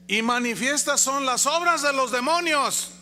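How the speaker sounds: misquoting the verse loudly to make a point; mock misreading. Shown as a demonstration. Preacher misreading the verse to his congregation, loud and emphatic. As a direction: proclaim the wrong word with false confidence.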